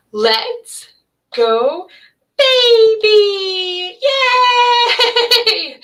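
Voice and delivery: put-on high happy baby-talk cheer; excited motherese. Shown as a demonstration. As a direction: squeal it up high to delight a baby. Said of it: "my voice goes higher with babies, and that's called motherese".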